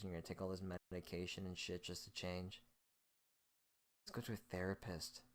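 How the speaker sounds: ordinary delivery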